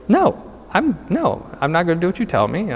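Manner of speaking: high-pitched, lightly indignant refusal; mock defiant refusal. Hearse driver refusing the woman's order to move, mildly defiant. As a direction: dig in, pitching the protest up.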